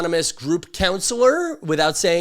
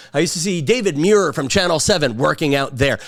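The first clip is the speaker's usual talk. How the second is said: ordinary talk, nothing marked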